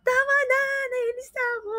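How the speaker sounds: shrill mock sister losing patience; annoyed sister. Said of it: "Tapos sabi niya". (ginagayang sigaw ng inis na ate)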